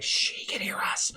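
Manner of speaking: unvoiced hush, barely there; whisper. [name] warns the family she can hear them: whisper. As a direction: breathe it so she can't overhear.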